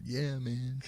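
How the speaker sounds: lazy, dopey stoner drawl. Retold or imitated. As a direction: play a laid-back stoner. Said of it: "Totally sounded like a stoner".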